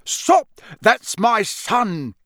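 high, loud, drawn-out shout; desperate yell. A narrator's reading. Father halting wizards attacking his son, desperate yell. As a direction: yell to protect your child. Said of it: "yelled a voice he recognized".

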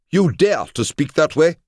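very high, loud indignation; outraged guard. A narrator's reading. Samurai guard confronts his master's insulter, high outrage. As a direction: challenge him in a high, affronted voice.

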